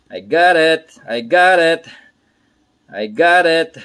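loud, high cheer of success; triumphant call. Real, not acted. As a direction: celebrate loudly, punching each repeat. Celebrating success at his task.

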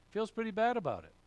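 a preacher's pointed repeat; emphatic repeat. Shown as a demonstration. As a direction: toss it back up high and quick. Preacher repeats the phrase for effect.

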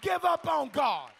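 high, shouted preaching; rousing revival-preacher shout; full-force preaching. Real, not acted. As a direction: shout it out in driving, drawn-out phrases.

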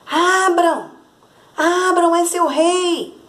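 high, loud royal demand at the door; king shouting. A narrator's reading. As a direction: bang on the door and bellow like a king. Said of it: "de tanto insistir e gritar". (rei gritando alto para abrirem a porta)

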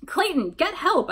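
high, drawn-out call; urgent yell. A narrator's reading. Narrator sending Clayton off for help, urgent yell. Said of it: "I yelled".

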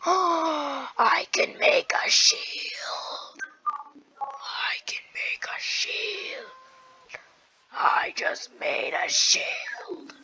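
long, slow gasped whisper; awed whisper. Pretend. Player discovers shield crafting, awed drawn-out whisper. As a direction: whisper it slowly in breathless wonder.

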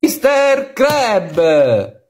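loud TV show announcer; annunciatore. Acted. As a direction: present the show big and loud. Annuncia la puntata e i personaggi. (annunciatore a voce alta)

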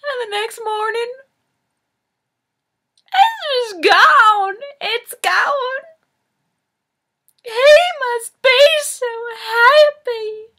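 very high, melodramatic wail; hammy tearful climax. Acted. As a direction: overplay the grief in a squeaky, drawn-out cry. Monologue climax about vanished tuxedo.

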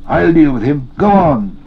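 high, loud and pressing; urgent urging. A narrator's reading. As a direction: shout him onward, no time to waste. Sam urges master onward.

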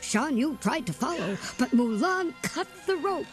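ordinary delivery, nothing marked

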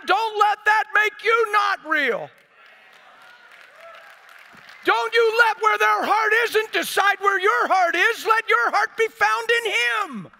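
high, loud exhortation, drawn out; full-force preaching. Real, not acted. Preacher urging the listeners to keep their hearts real, full-force preaching. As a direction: plead it out at the top of your range.

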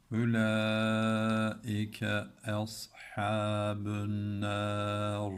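slow chanted reading; slow tajweed recitation; Quran recitation. Shown as a demonstration. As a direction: intone slowly and melodically. Recites verse ending.

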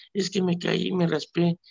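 put-on high, small voice of a hurt little boy; child's voice. Priest retelling a scraped boy's answer, high child voice.

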